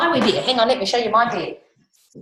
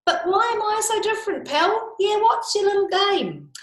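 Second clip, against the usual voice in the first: much higher, indignant koala asking why; outraged high koala; 12 semitones higher than usual. Acted. Koala demanding of the listeners, high and outraged. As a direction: demand an answer, pitched right up.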